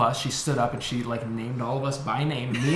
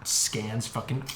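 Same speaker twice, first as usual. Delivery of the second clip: ordinary delivery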